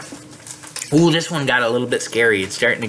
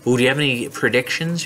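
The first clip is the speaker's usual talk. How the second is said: usual speaking voice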